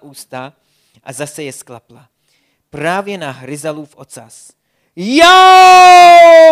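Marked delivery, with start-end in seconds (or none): 5.0-6.5 a piercing, much higher, much louder ouch; loud yelp of pain. Acted. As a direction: yell out as the shell bites your tail. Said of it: "hlasitě zařval bobr".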